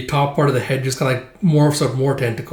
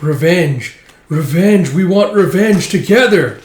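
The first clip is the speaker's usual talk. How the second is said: loud, raised ghostly chant; clamouring spirits; 5 semitones higher, 7 dB louder than usual. Acted. Specters climbing all over him, clamouring for revenge. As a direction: wail it out like vengeful specters. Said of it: "all these specters and spirits".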